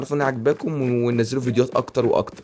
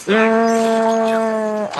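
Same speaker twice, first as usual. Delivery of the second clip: long high cry; 9 semitones higher, 3 dB louder, much slower than usual. Real, not acted. Gamer under fire in match.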